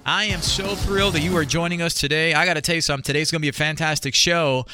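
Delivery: ordinary talk, nothing marked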